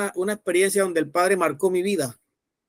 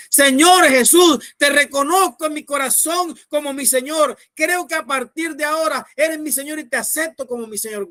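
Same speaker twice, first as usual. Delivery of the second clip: fervent prayer; 6 semitones higher than usual. Shown as a demonstration. Preacher modelling the oración de fe for evangelists, fervent prayer. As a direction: call out to God, pitched well up.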